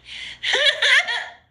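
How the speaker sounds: loud, high helpless laugh; high burst of laughter. Real, not acted. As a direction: crack up suddenly, high and loud. Laughs at feeling on camera.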